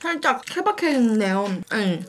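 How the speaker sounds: talking between bites; chewing. Real, not acted. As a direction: mouth full of rusk. Seen 5: Bites rusk at -4, chews with closed mouth throughout.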